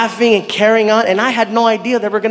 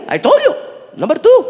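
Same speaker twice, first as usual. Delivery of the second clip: put-on high-pitched Saudi-accented retort; accented driver; 9 semitones higher than usual. Driver reminding the surprised comic, high and accented.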